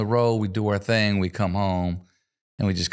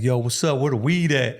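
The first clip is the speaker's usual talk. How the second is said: lightly voiced [name] greeting, a bit higher; casual mock [name], slightly raised; imitating [name]; 4 semitones higher than usual. Storyteller quoting [name] joining the backstage circle, lightly imitated. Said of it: "He's like".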